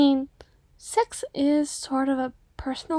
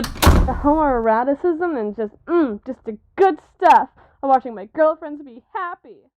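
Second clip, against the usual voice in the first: put-on swooning over her cute girlfriends; gushing excitement; 7 dB louder than usual.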